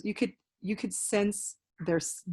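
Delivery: ordinary talk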